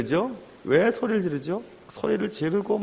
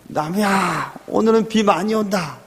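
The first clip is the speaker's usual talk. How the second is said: higher-pitched motherly warning; mother calling to her child; 4 semitones higher, 3 dB louder than usual. Retold or imitated. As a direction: call out to your child, telling them to stay home. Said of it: "이러시더라고요". (아이를 부르며 말리는 어머니 말투)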